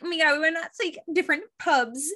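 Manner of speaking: high, gushing tourist gush; mock gushing tourist. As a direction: ham up a tourist raving about pubs.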